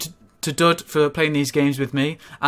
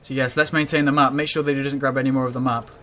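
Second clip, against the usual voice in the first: ordinary delivery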